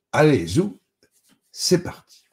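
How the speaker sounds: high, loud send-off; rally the team with lifted voice; rallying call. A narrator's reading. Claps hands to start work. (appel entraînant, haut et fort)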